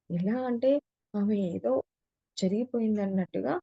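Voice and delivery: ordinary delivery, nothing marked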